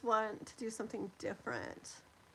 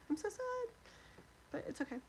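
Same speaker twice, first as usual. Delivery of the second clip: sad and tearful; 11 semitones higher, 7 dB quieter, faster than usual. Real, not acted.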